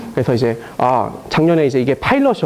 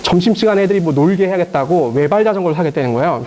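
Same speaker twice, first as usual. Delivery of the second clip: ordinary talk, nothing marked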